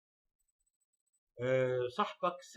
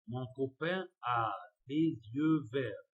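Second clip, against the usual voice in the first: very slow dictation; 4 dB louder, much slower than usual. Shown as a demonstration. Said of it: "reading French answer very slowly".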